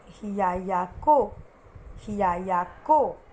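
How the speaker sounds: teacher models हियायाको twice; slow careful pronunciation. Shown as a demonstration. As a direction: say हियायाको slowly syllable by syllable. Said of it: "एक बार मैं फिर से बोलूँ".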